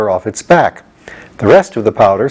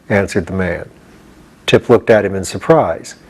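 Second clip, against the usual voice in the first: ordinary delivery, nothing marked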